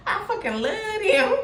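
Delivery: high, laughing rush of delight; laughing gush. Real, not acted. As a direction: squeal it through laughter. Gushing over the King of Cups card.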